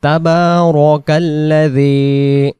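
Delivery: slow melodic tajweed reading; Quran recitation. Shown as a demonstration. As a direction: recite it as a model for students. Teacher recites word by word. (তাজবিদসহ তিলাওয়াত)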